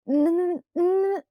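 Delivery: high chattering stammer; shivering stammer. A narrator's reading. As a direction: protest through chattering teeth. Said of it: "I tried to protest".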